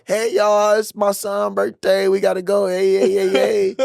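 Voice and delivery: rally the whole plane for a birthday; rowdy passenger. Retold or imitated.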